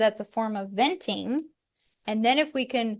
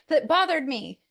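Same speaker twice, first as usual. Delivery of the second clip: higher and fast, laughing at herself; fast exasperated self-quote, a little laugh in it; exasperated retort; 6 semitones higher, faster than usual. Retold or imitated. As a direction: replay the blurted, half-laughing defence. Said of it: "I'm like".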